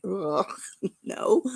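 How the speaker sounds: whispered laughing ugh, no; disgusted whisper. Retold or imitated. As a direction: breathe out a grossed-out refusal. Disgusted refusal.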